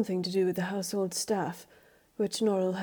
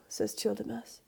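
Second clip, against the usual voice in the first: ordinary delivery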